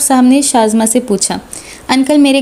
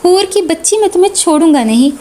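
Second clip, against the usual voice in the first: angry threat; 5 semitones higher than usual. Acted. Angry brother chasing sister.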